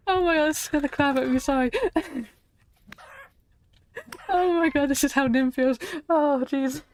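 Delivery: high, loud, rushed fluster after a scare; shaken panic. Real, not acted. Streamer recovering from a jump scare, flustered and loud. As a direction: babble in real fright, still laughing it off. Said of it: "That actually scared the crap out of me".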